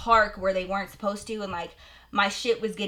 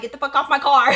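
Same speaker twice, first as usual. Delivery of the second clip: raised, louder, angry retold order; going off; 5 semitones higher, 9 dB louder, faster than usual. Car owner ordering the neighbor away, going off angrily. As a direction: act out telling someone off, pitched up and loud. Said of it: "I'm going off".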